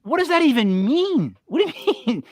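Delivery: high, incredulous what; baffled. Retold or imitated. Host recalling his shock at a Southern saying, high and baffled. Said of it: "I'm like".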